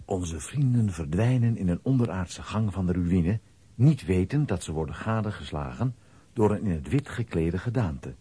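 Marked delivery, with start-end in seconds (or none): none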